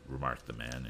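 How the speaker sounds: plain reading voice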